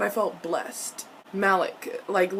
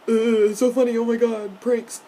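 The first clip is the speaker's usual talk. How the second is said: mocking giggler; 5 dB louder than usual. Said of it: "Jace giggles".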